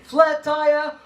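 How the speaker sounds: loud, high call; attention-getter call. Shown as a demonstration. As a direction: shout the cue to the class. Calling the cue to the class.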